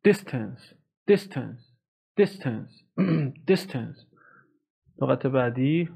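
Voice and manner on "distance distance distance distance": "Distance" with the first syllable hit harder; stress on first syllable. Shown as a demonstration. Teacher to class, stressing the first part. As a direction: press hard on "Dis" in "Distance".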